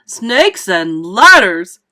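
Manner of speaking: very loud, drawn-out exclamation; alarmed yell. A narrator's reading. Fire chief spots another monster, yelling. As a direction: yell it slowly in horrified alarm. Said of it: "yelled the head of the fire department".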